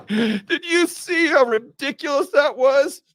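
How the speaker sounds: high, loud gloating laugh and shout; hammed-up gloating elite; villain laugh. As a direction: cackle at the fools.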